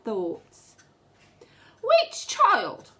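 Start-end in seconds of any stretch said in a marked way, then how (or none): 1.8-2.9 put-on high, sly witch muttering "Which child"; a witch picking her next victim, pitched up; scheming witch. Witch plotting to herself, high and sly. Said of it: "she says to herself".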